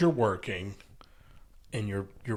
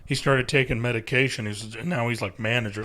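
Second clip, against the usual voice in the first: usual speaking voice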